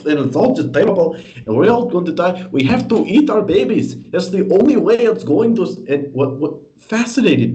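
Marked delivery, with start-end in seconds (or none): 0.1-5.7 high-pitched mock doomsaying activist; imitating the town hall woman's frantic rant, hammed-up; mocking weird accent. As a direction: lampoon her panicked climate rant. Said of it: "in this weird accent".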